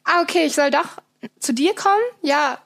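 high, bright pretend phone chat; fake phone call. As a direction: act like someone's calling you. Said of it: "so tut, als wäre man ans Handy gegangen".

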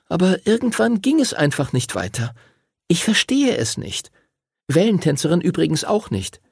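put-on high, slightly frustrated girl's voice; girl reporting. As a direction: report the failed flight in a high voice. Voices Lucy reporting her failure.

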